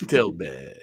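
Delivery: low, drawn-out put-on voice; Matt Berry impression. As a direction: savor the name in a deep actorly voice. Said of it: "it sounds like him doing this voice".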